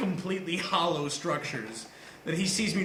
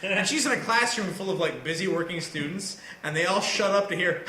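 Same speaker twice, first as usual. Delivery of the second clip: ordinary delivery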